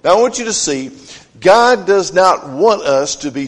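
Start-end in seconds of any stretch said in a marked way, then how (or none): none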